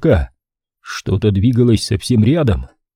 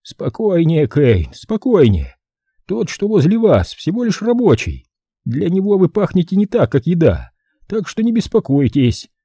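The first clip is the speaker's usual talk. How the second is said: higher calming voice over an earpiece; leaking from hidden earpiece; 8 semitones higher than usual. Acted. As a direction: soothe him through the earpiece. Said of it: "В наушнике зазвучал голос Кроули".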